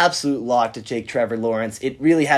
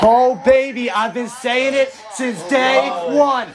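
excited shouting; 11 semitones higher than usual. Real, not acted. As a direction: gloat at the top of your voice. His draft prediction comes true.

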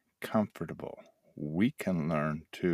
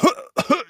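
high, loud spluttering cough; mock sputtering cough; 8 dB louder than usual. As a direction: cough like you swallowed water. Sputtering after going underwater.

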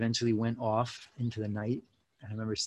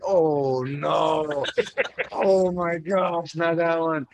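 playful mock dismay, higher pitched; mock groan; 6 semitones higher, 5 dB louder, much slower than usual. Students dreading the thirty-stanza song, mock dismay. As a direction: groan at the long song, laughing. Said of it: "we would all be like".

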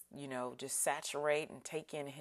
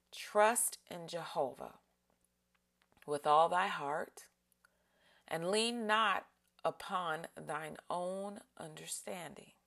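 slower scripture, longer pauses between phrases; slow meditative reading; slower still; 2 semitones higher than usual. Shown as a demonstration. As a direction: linger on every phrase. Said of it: "read it slower and slower".

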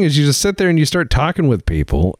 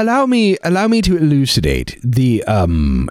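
mock British accent; 4 semitones lower than usual. Official explaining the balance of power in the empire, pompous mock British accent. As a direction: hold forth like a hammed-up British colonial grandee. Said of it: "with the most British accent you can".

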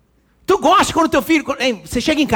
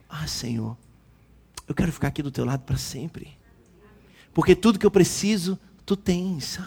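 soft, hushed prayer leaning on Jesus; intimate prayer; 3 semitones lower, 6 dB quieter than usual. Shown as a demonstration. Preacher shows the church a hushed, tender prayer. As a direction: pray it tenderly, much softer. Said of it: "encostar no peito de Jesus e falar assim".

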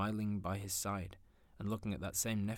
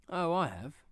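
bright nephew; 9 semitones higher, 6 dB louder than usual. Acted. Nephew answers wife.

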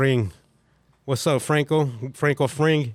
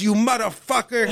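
higher, mock-furious curse; mock angry curse; 8 semitones higher than usual. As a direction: spit it like the cheated scammer. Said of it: "this fool goes".

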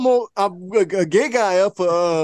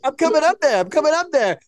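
imitating [name]; 6 semitones higher than usual. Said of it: "He's like".

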